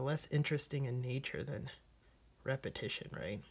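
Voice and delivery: ordinary talk, nothing marked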